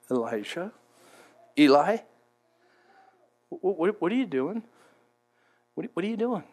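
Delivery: very soft, hesitant, gentle question; delicate whispering voice. Acted. Preacher voices God calling Elijah in cave, delicate whisper. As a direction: coax him softly, with long pauses. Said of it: "it was a delicate whispering".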